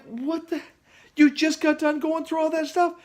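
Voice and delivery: can't believe she just fell; surprised. Retold or imitated. Reacting to wife falling off.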